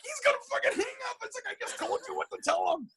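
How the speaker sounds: very high, frantic squeal; panicked exasperation. Retold or imitated. Acts his panic as Jan stalls.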